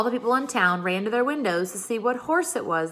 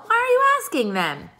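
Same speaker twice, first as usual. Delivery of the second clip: baffled question; 5 dB louder than usual. A narrator's reading. Pippi baffled at teacher.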